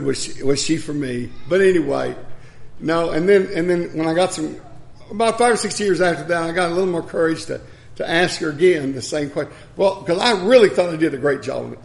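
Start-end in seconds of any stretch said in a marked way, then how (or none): none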